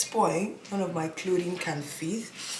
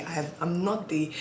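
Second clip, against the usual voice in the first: usual speaking voice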